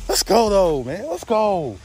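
loud, high whoop of triumph; pumped-up victory call; excited cheer. Real, not acted. Celebrating a hard-won bass catch.

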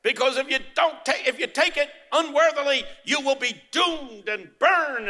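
high-pitched imitation of a threatening preacher; condemning minister. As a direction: mimic a minister warning of damnation. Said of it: "When the minister said".

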